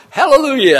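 high, loud burst of joy, Hallelujah flung out; joyful shout. Retold or imitated. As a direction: shout it like sudden relief and joy. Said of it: "And I went".

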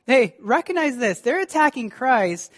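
ordinary delivery

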